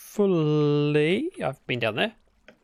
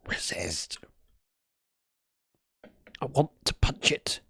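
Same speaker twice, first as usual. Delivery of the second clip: low mutter; 3 semitones lower, 5 dB quieter than usual. Real, not acted. Low muttered self-warning.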